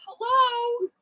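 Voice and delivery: big booming hello, imitating grandma; boisterous hello. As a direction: call out a loud cheerful hello. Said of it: "the way your boisterous".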